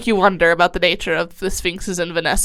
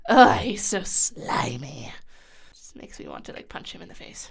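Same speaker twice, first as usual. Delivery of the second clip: disgusted whisper; 6 semitones lower, 6 dB quieter than usual. Real, not acted. Disgusted by the villain.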